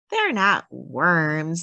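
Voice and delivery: plain reading voice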